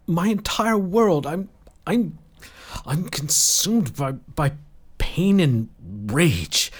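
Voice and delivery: high, halting, agitated confession; distraught rage. A narrator's reading. Lloyd confessing to Asha he's consumed by rage, distraught. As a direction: stumble over the words, overwhelmed, voice rising.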